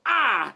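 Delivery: sharp high yelp of pain; a quick ouch at the jab; mock yelp. Person jabbed by a needle, yelping. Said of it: "is to go".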